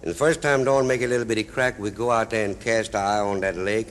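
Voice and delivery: ordinary delivery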